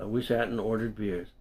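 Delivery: plain reading voice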